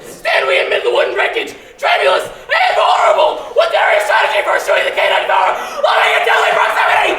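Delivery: very high, loud shrieking squeal; panicked squeal. Acted. Pig two shrieks about the wolf's closeness. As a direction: screech in blind panic. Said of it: "squealed two".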